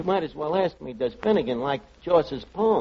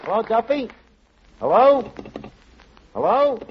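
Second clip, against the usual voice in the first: calling out into phone; 5 semitones higher than usual. Acted. Calling into phone after song.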